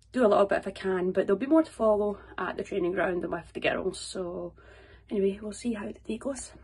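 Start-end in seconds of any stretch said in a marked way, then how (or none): none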